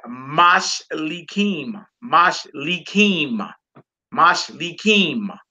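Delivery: "Mashlikim" slowly as mahsh-lee-KEEM; last-syllable stress. Shown as a demonstration. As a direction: draw out each syllable of "Mashlikim", landing on "kim". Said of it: "Accent on the last syllable".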